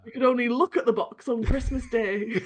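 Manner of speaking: mock hard-done-by Yorkshire; Monty Python Yorkshireman. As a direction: top the last boast in Yorkshire. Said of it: "like that old Monty Python sketch".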